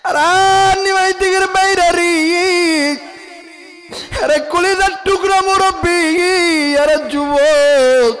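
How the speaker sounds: high, loud, slow wailing address; impassioned preaching. Real, not acted. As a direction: cry out to the crowd with drawn-out endings. Preacher wails to the audience.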